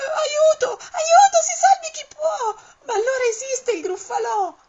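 very high, loud panicked shout for help; terrified cry. Acted. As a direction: scream as the monster appears. Topo incontra il vero gruffalò. (grido di terrore, molto acuto e forte)

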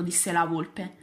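usual speaking voice

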